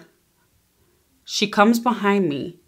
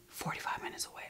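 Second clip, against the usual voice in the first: whispered under the breath; quick whispered aside to viewers; 23 dB quieter, faster than usual. Real, not acted. Aside about dad's distance.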